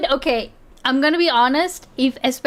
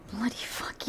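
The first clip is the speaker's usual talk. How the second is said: whispered echo; 3 semitones lower, 16 dB quieter than usual. Retold or imitated. Viewer quietly repeating the clip's swearing in a whisper. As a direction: repeat "bloody fuck you" as a soft unvoiced whisper.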